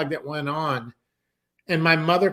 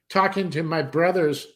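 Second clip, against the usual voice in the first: normal talking voice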